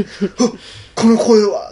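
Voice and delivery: mock alarm at a familiar voice; acted startled recognition. Hero startled by the monster's voice.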